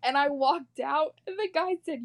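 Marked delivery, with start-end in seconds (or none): none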